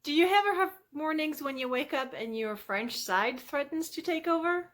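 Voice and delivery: ordinary talk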